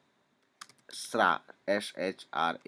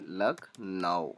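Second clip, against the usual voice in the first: slow spelling demo; much slower than usual. Shown as a demonstration.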